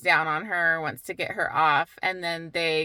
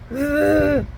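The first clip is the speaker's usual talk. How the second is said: a loud, high groan of disgust; frustrated groan; 8 semitones higher, 6 dB louder than usual. Real, not acted. Reader fed up with the hero groans loud and high. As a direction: let out an exasperated ugh, louder and higher. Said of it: "I'm mad".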